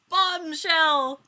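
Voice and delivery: loud, high punchline call; dramatic announcement. A narrator's reading. Narrator announcing the twist, loud and high.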